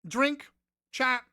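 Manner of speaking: ordinary delivery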